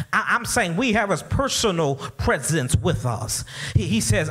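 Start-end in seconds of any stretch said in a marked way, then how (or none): none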